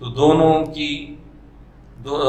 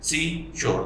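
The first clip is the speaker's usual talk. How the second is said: "सी, शोर" as two separate pushes; split into syllables; 2 semitones higher, 3 dB quieter, much slower than usual. Shown as a demonstration. Teacher showing the word has two syllables, spoken in two beats. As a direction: break सी away from शोर with a pause. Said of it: "दो झटकों में ये".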